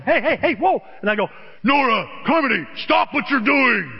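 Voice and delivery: put-on loud, high frantic holler at the kids; possessed yelling. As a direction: yell like a grandpa possessed. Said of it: "Like I was possessed".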